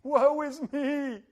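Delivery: loud, high, slow woe-is-me wail; mock self-pity at his own pity party; mock lament. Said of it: "throw a pity party".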